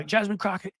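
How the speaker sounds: high-pitched ranting imitation; mock yelling. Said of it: "she's just yelling all sort of random crap".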